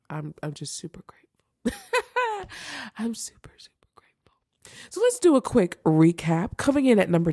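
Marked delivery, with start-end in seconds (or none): 0.1-4.3 soft whisper, moved, breaking into a little laugh; whispered thanks. Real, not acted. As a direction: breathe it out, overcome and grateful. Emotional thanks at favourite movie.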